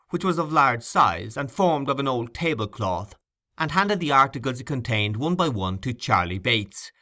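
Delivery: ordinary delivery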